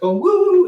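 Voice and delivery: high, loud "woo"; imitated whoop. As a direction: whoop like an excited crew.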